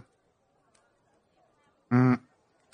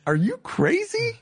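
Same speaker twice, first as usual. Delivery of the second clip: put-on sassy teen voice. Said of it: "cocking my head".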